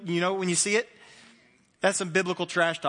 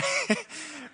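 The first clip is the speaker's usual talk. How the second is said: short laugh; 11 semitones higher than usual. Real, not acted. Laugh about Monday mornings.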